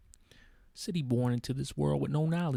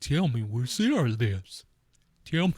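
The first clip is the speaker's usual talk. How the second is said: ghost voice; 3 dB louder than usual. Pretend. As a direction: moan it like a ghost. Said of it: "Why do I sound like a ghost".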